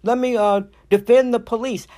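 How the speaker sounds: shrugging off a caricature of herself with a lightly hammed-up impression; mock defender. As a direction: mimic a gushing police defender, tongue in cheek. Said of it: "I am not here to say".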